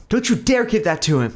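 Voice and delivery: high, loud angry shout; mother yells at Karsten; furious mother. A narrator's reading. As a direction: scream to stop him. Mom fighting for vial.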